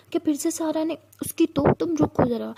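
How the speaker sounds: mildly acted outrage, ready to confront someone; protective indignation. A narrator's reading. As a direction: bristle on a friend's behalf. Sia angry at whoever upset Tara.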